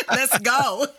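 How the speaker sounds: high, laughing burst of excitement; excited cheer. Real, not acted. Hosts cheering each other as the show wraps, excited.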